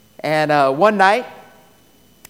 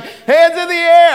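raised, loud mock police command; police shout; 10 semitones higher, 9 dB louder than usual. Police surprise him at night by the window, shouted order. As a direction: bark it like an arresting officer.

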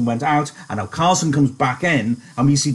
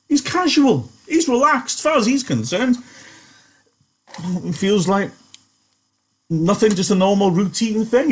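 high emphatic explaining; high, animated explaining; 7 semitones higher than usual. Real, not acted. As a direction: press the point, pitched high. Teacher explains Carlson's attitude.

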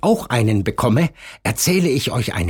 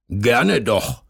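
grumbling man. A narrator's reading. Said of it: "brummte der Mann ins Telefon".

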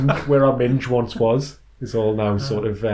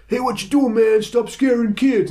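high, loud mock confrontation; imitated shout of a guy telling someone off; angry man in car; 12 semitones higher, 4 dB louder than usual. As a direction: holler it as an outraged driver, pitched right up. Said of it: "this guy in the car just went".

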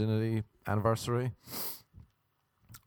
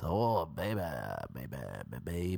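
Carmen voice; 4 semitones lower, 4 dB quieter than usual. Acted. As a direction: stretch out the last word in a character voice. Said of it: "Do my Carmen voice".

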